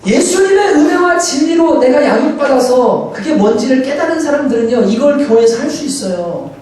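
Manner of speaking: high, insistent sermon delivery; impassioned preaching. Acted. Pastor urging his church to embrace the weak, impassioned preaching.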